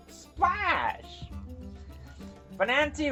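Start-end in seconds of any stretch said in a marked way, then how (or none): none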